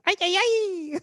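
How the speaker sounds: high-pitched Mexican-style "ay, ay, ay"; mock Mexican cry. Co-host joining the Mexican dancing bit, mock Mexican whoop.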